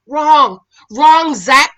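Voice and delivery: loud repeated wrong, calling names; scolding. Real, not acted. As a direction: tell them off firmly. Scolding people on TV.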